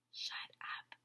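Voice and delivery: breathless hushed whisper. A narrator's reading. Narrator whispering what the sign means. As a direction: whisper it like a secret order.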